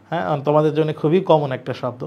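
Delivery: normal talking voice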